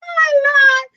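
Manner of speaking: high squeal of laughter; laughing exclamation. Real, not acted. Laughing after teasing.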